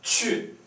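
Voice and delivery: qu hoch ansetzen, scharf fallend; fourth tone. Shown as a demonstration. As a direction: qu kurz abfallen lassen. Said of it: "Fourth tone".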